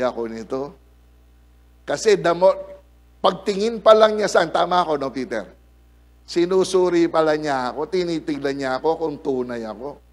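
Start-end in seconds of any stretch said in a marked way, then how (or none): none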